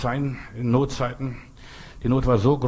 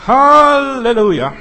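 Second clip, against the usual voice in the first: halleluja flung out much higher, louder and drawn out; ecstatic shout of praise; 13 semitones higher, 13 dB louder, slower than usual. Acted. Preacher crying out to the congregation, ecstatic halleluja shout. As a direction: lift the roof with one long halleluja.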